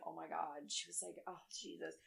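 quiet imitation of a dismayed listener; hushed dismay. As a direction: say it under your breath, as if appalled. Therapist's dismayed reaction retold.

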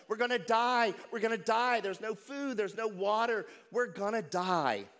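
high, loud panicked yell of doom; panicked screaming. Retold or imitated. Shipwrecked man screaming to his friend, panicked yell. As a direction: scream it like a castaway sure he'll die. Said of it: "started screaming and yelling".

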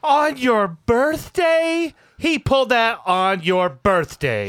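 high, slow, drawn-out outrage; outraged gossip. Acted. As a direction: gasp it out like a shocked friend. Gossiping friend voice.

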